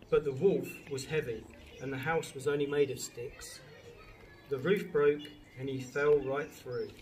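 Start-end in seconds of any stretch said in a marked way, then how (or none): none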